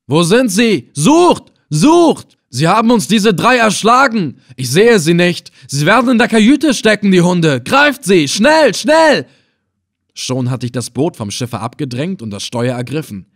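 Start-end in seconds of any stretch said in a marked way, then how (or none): 0.1-9.3 high, loud roaring commands. Acted. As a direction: bellow at your men, high and loud. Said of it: "die brüllende Stimme Assels". (laut brüllend, hoch und wütend)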